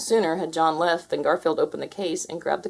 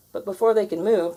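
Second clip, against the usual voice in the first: plain reading voice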